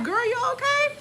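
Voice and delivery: high-pitched worried check-in; high concerned ask. Retold or imitated. To her doctor who was cussing, high concerned check-in.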